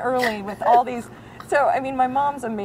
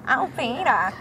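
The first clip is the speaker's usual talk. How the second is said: Lois impression; slower than usual. Imitating Lois.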